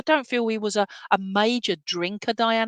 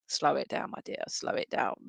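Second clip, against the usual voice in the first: low, soft grandfatherly urging; imitating Gran for Bill; 6 semitones lower, 7 dB quieter than usual. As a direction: voice a grandfather quietly easing the pace. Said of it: "Gran for Bill's just like".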